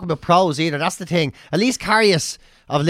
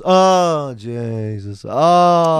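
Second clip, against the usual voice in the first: mock groan; 3 semitones lower, much slower than usual. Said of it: "get the groaners".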